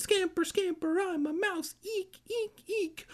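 very high little mouse voice; squeaky mouse. Acted. Reader plays the scampering mice, squeaky mouse voice.

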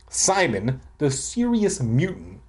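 ordinary delivery

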